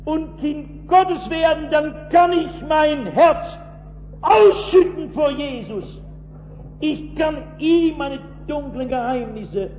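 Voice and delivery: high, loud, slow preacher's appeal; fervent preaching. Acted. Preacher urging his listeners to become children of God, slow and ringing. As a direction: lift the voice and press each word slowly.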